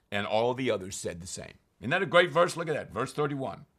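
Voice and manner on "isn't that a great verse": ordinary delivery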